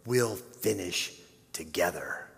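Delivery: father comforting his injured son, gently. A narrator's reading. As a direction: promise it close to his ear. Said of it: "Jim said gently".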